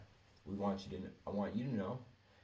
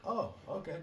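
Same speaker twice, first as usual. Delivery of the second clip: much higher mock-casual oh okay; fake casual okay; 11 semitones higher than usual. Pretending calm before jumping the coworker, pitched up. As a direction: play it cool, pitched way up.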